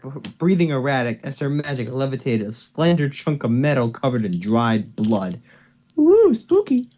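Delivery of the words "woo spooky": much higher and louder mock-scared woo; mock spooky. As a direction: ham up the spookiness. Mock reaction to creepy story.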